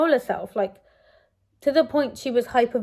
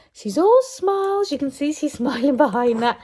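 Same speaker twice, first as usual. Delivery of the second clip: delighted cooing; 5 semitones higher, 6 dB louder than usual. Real, not acted. Delighted talk over her happy baby.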